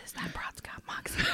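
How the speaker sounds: soft whispered aside. Real, not acted. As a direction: whisper it close to the mic.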